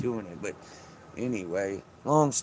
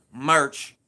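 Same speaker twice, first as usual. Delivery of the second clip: loud catchphrase; 2 semitones higher, 5 dB louder than usual. Pretend. Vlogger shouting his catchphrase at the camera, loud. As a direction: punch Merch out loud.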